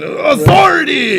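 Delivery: slow, drawn-out high mock shout; sarcastic drawn-out shout. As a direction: bellow it like a sarcastic slogan. Mocking cops, shouted slogan.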